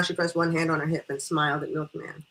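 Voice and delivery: ordinary delivery, nothing marked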